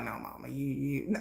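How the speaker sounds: very soft, low imitation of a son; quiet son's reply. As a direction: drop way down for the child's hesitant answer. Son's reply to mother retold.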